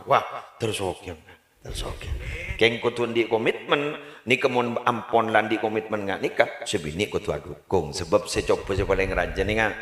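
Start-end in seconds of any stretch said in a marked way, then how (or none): none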